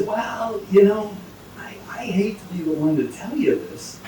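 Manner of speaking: hushed, breaking it gently; confiding whisper. Acted. Mother Superior whispers bad news to the leprechaun. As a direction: whisper it as a secret.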